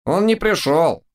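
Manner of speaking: very high thin voice. A narrator's reading. Tall acolyte answering the judges, in a thin high voice. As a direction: squeak it out high. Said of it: "тонким голосом откликнулся".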